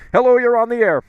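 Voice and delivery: host picking up the next caller, bright announcer read; radio host greeting. Acted. Radio host taking a call.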